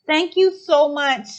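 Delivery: ordinary talk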